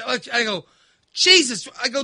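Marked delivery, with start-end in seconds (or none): 1.1-1.7 high loud yelp of a word; loud exclamation. Retold or imitated. Son scalded by coffee, high loud yelp. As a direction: blurt it out in shock.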